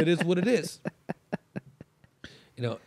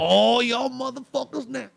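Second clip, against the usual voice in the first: higher, slow, grumbling angry voice; talking shit; 8 semitones higher, 4 dB louder, slower than usual. Retold or imitated. Uncle grumbling while coming down the hall, angry. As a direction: grumble like a woken giant. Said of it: "he's already talking shit".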